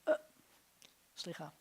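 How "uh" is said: "uh" as a short, quick grunt; shva sound. Shown as a demonstration. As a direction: give "uh" as one brief neutral vowel. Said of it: "a moving shva sounds like".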